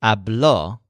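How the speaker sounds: habló with the final syllable, bló, stressed; stress on last syllable. Shown as a demonstration. Teacher reviewing the past tense, final stress on habló. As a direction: end on a stressed, falling o in habló. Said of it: "But the past tense is".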